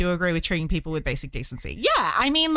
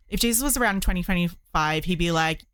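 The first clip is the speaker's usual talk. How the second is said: normal talking voice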